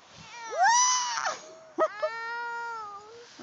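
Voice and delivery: high squealing laugh while sliding; shrieking laugh. Real, not acted. After push down hill.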